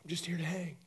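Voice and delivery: soft, casual, unbothered; softly reassuring the panicked crowd; calm quiet reply. Retold or imitated. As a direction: shrug it off quietly. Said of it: "He's like".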